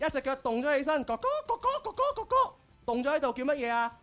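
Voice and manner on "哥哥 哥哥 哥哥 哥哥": high, sing-song begging voice; playful high wheedling; cutesy high pleading. Acted. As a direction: plead like a sweet little sister, high. Host repeats cutesy plea.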